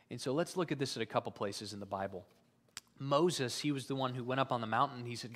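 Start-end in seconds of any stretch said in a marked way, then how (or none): none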